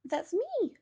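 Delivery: tiny voice answering; squeaky little bear. A narrator's reading. Teddy hearing the children, high squeaky thought. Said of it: "thought Teddy".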